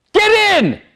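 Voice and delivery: wife losing patience, shouts at husband to get in; commanding shout. Retold or imitated. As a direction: yell it at him. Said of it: "and she goes".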